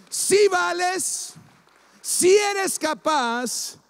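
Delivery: proclama agudo, muy lento y sostenido; afirmación ferviente. Real, not acted. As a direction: alarga cada afirmación con la voz en alto. Predicador afirma a la congregación. (afirmación ferviente de predicador, aguda y lenta)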